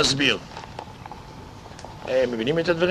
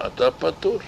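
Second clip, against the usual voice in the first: ordinary delivery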